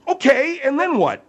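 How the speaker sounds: loud, high "and then what"; impatient prodding. Acted. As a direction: push for the point, exasperated. Host impatiently prods the guest.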